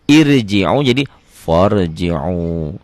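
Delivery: irji'u then farji'u, the ro turning heavy, slow; Arabic contrast. Shown as a demonstration. Teacher demonstrating farji'u to students, slow. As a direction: slowly show irji'u becoming farji'u. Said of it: "Jadi tebel".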